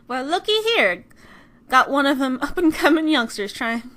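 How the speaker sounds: loud, hammed-up southern accent; bad southern accent. Player greeting McCree in a really bad southern accent. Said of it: "speaking in a really bad southern accent".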